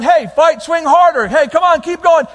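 loud, high coaching shout; pumped-up corner man yelling. Acted. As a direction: cheer him on like a coach at ringside. God as coach urging harder.

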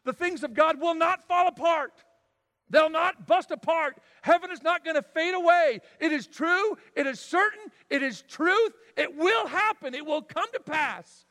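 loud, high, fervent preaching; rising pulpit fervour; preaching at full force. Acted. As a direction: thunder it from the pulpit.

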